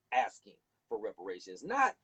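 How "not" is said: ordinary delivery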